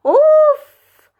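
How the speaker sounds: high loud exasperated "oof"; overwhelmed oof. Real, not acted. Learner overwhelmed by Turkish letters, high exasperated oof.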